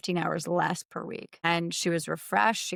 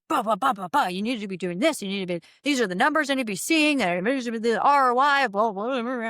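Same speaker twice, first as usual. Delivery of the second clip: higher, louder, fast hammed-up lecturing boss; mocking pushy investor; 4 semitones higher, 4 dB louder than usual. Retelling a meeting, mimicking the investor's lecturing. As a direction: rattle it off like a pompous investor lecturing.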